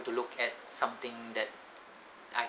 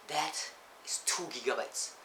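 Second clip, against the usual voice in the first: amazed; 4 semitones higher, slower than usual. Real, not acted. Amazed at internet speed.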